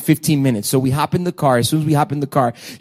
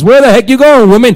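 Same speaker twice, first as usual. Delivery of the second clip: louder, exasperated phone broadcast; mock shout on the phone message; 6 semitones higher, 12 dB louder than usual. Pastor imagining his phone message to congregation, mock loud outburst. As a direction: blurt it into the congregation recording. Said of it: "imagine you guys".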